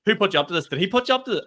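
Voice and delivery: a panicked, suspicious punter; panicky. Retold or imitated. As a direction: voice shooting up in alarm. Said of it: "And he was like".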